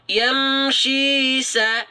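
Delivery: yamshi sa drawn out slowly; slow recitation. Shown as a demonstration. Teacher models recitation for viewers, slow. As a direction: recite yamshi sa with two-beat lengthening.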